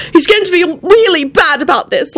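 high, upset scolding cry; shouted scolding. A narrator's reading. Elizabeth warning Quentin and Lucretia, shouting. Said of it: "Elizabeth shouted at Quentin and Lucretia".

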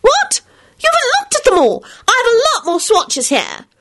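very high, shocked outburst; be appalled they stopped looking; indignant surprise. Acted. Solange aghast in story.

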